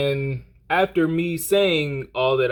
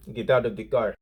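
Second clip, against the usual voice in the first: put-on Arabian accent; 3 semitones lower than usual. Angry driver orders him out of the car, mock Arabian accent. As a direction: imitate an Arabian man's accent, held down. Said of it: "I need to stop doing the accent".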